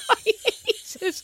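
high, laughing protest, drawn out; helpless laughter. Real, not acted. Co-host embarrassed by a rude term, laughing protest. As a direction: laugh through the words, slow and high.

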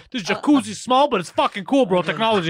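hammed-up surfer dude, laughing at the end; clueless bro. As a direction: play a god thrilled by a tiny tub, bro-style. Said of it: "He's like".

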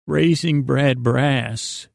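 normal talking voice

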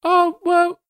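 high, chirpy little creature voice; squeaky fey creature. Acted. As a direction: play a sweet tiny fey critter. Turnip creature speaking.

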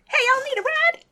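very high yelping call from afar; Rudy's yelp. Acted. Game master voicing Rudy yelping at runners in the distance. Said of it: "you hear Rudy yelp".

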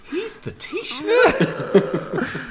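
very high, drawn-out incredulous squeal through laughter. Retold or imitated. Recalling the prime minister's accent gaffe, incredulous. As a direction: squeak it slowly in laughing disbelief. Incredulous retelling of his own reaction.

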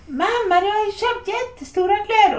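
put-on high-pitched kid on the phone; a son's high complaint to mum; child calling mum. Son phoning his mum about huge clothes, high child's voice. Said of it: "så hör jag när han ringer mig".

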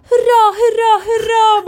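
high, loud cheering hurra hurra called out from another room; joyful shouting. Retold or imitated. Imitating the old lady cheering from the toilet, loud and high. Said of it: "satt och ropade där".

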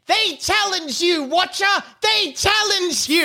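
high-pitched excited little cry; Sarah the Little piping up. Acted. Sarah the Little interrupts the attack on Watcher, piping up shrilly. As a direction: pipe up high, calling out. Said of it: "Sarah the Little pipes up".

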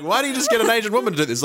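high, fast disbelieving question; incredulous question. Retold or imitated. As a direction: fire it off in disbelief. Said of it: "And you're like".

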